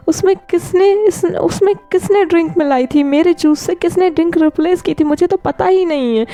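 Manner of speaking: high, breaking bewildered pleas; distraught Wing. Acted. Wing asks his friends who spiked his juice, high and distraught. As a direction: ask desperately, voice climbing high.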